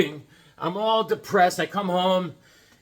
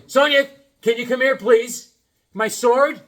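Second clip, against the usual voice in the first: higher, louder call across the house; 5 semitones higher, 6 dB louder than usual. Real, not acted. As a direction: call her from the next room. Calling the kid from afar.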